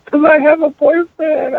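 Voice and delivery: high, stretched-out mock sob; slow tearful wail, put on; imitated crying. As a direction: play the crying daughter confessing. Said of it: "Why are you crying?".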